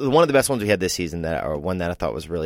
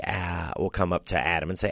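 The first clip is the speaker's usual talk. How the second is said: usual speaking voice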